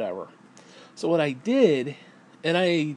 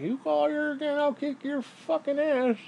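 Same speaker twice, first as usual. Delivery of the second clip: mock tough-guy threat; 12 semitones higher than usual. Quoting the man threatening the ex-husband, hammed-up. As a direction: ham up a macho threat in a squeaky voice. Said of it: "again, he's like".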